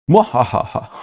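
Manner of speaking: sneering panto villain cackle; gleeful mwahaha of a villain; evil laugh. Shown as a demonstration. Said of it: "real evil and jokery".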